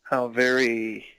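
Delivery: mock praise, pitch jumping up on the last word; sarcastic. Real, not acted. Mocking a cartoon's lazy name, sarcastic.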